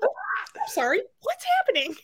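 high, laughing disbelief; laughing, very high bewildered question; baffled. Retold or imitated. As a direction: squeak out the confusion through laughter. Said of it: "She's like".

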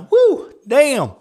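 loud high whoop after coughing; excited. Real, not acted. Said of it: "I'm getting excited".

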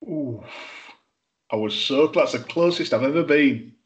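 normal talking voice

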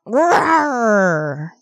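deep drawn-out mock lion roar. Radio host playing a lion for listeners, hammed-up roar. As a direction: roar like a lion to sell the ranch. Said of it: "my best impersonation of a lion".

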